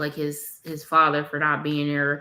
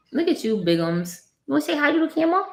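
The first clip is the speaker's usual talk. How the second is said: sing-song invite to wave at the camera; 9 semitones higher, faster than usual. Real, not acted. Mother coaxing baby to camera.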